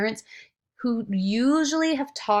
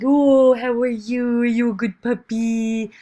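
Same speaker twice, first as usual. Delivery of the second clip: talking to my dog; 3 semitones higher, 9 dB louder than usual. Retold or imitated. Owner cooing at her puppy in loud baby talk. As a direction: coo at the dog in doggy talk. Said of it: "I'm like".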